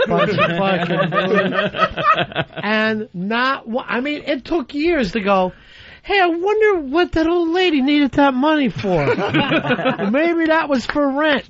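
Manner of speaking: high, laughing aside; laughing through it. Real, not acted. Host laughing at his younger self while telling the caller.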